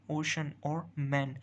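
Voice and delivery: ओशन और मैन said slowly, each word set apart; teacher contrasting ओशन and मैन slowly. Shown as a demonstration. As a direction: lay the two words side by side slowly. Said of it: "कितना फ़र्क है".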